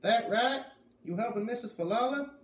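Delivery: put-on Maine farm boy speaking up loudly. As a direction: Zep talking to kids he thinks are deaf, loud Maine accent. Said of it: "He spoke louder, as if we were deaf".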